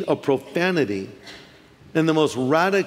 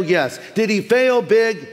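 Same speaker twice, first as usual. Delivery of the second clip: ordinary talk, nothing marked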